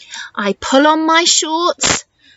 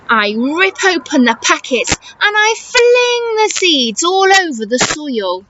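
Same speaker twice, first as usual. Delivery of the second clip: ordinary delivery, nothing marked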